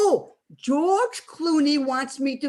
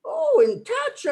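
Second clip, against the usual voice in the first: surprised; 12 semitones higher than usual. Acted. Old woman startled by the question, high surprised oh. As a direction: pitch leaps up on a startled oh. Surprised at being asked about him.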